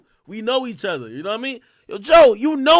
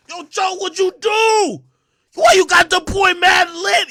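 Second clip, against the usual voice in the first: mock yell; 10 semitones higher than usual. Phoning Joe about the beef, playful high shout. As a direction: shout the question like breaking news.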